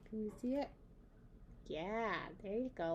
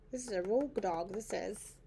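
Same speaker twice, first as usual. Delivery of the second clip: ordinary delivery, nothing marked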